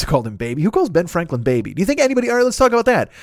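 usual speaking voice

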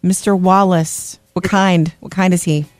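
usual speaking voice

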